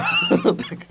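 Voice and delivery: a short burst of laughter; real laugh. Real, not acted. Narrator laughing at his own song. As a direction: laugh at yourself.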